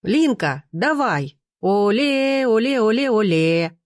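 high, sing-song chant; crowd cheer. A narrator's reading. Onlooker to Lina through cupped hands, cheering chant. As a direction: cheer her on, stretching the vowels into a chant. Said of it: "сложив ладони наподобие рупора, крикнул".

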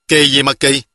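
usual speaking voice

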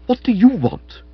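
high, loud, affronted woman; indignant demand. Acted. Florence demanding what the visitor wants, indignantly. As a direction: snap the question out loudly.

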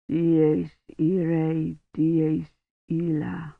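slow, soft, weak Latin murmur; dying man straining to chant; dying priest voice. Acted.

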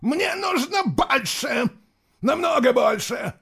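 furious high shout. Acted. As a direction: scream the demand. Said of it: "выкрикнул Суарак".

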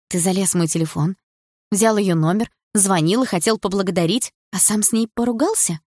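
ordinary delivery, nothing marked

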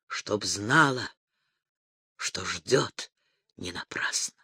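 put-on teasing whisper to his brother about the girl. A narrator's reading. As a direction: whisper it like a secret.

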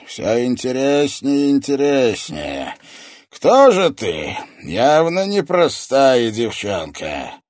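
booming, menacing villain; terrible villain's voice. A narrator's reading. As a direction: draw it out, sizing up the intruder. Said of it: "раздался всё тот же ужасный голос".

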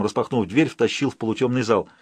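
plain reading voice

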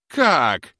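high, loud one-word shout; outraged cry. A narrator's reading. Old woman hears herself dismissed, cries out outraged. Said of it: "закричала старуха".

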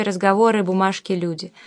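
plain reading voice

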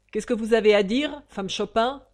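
lightly voiced presiding judge; questioning judge. A narrator's reading. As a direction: address the witness loudly from the bench.

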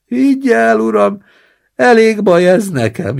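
put-on high, loud whining old man; wailing. Old man in the basin wails to his roommate, high and loud. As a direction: moan it out like a pitiful invalid.

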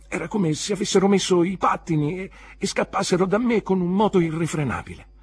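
breathless as if it all slips away; rising panic. A narrator's reading. Panic as lessons slip away. (panico crescente, voce più alta e forte)

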